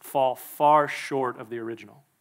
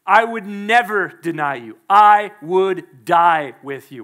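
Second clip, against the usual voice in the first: bold vow; 9 dB louder, slower than usual. Acted. Peter repeats his boast.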